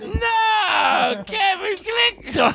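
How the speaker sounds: very high, drawn-out comic screech; mock-exasperated correction. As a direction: wail the fake name in mock outrage.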